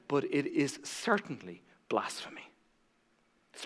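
whisper. Real, not acted. Preacher to congregation, hushed grave whisper.

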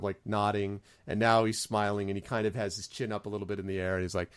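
ordinary delivery

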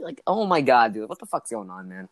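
usual speaking voice

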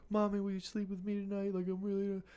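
put-on high, soft kid voice; imitating a small child asking her mom; pleading child. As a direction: ask like a little girl at bedtime. Voicing the daughter at bedtime.

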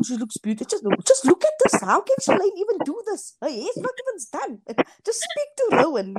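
high-pitched, fussing mom impression; playfully imitated motherly complaint. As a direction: mimic a nagging mother, swooping up high. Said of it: "she would say".

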